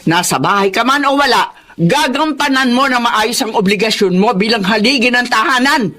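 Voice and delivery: normal talking voice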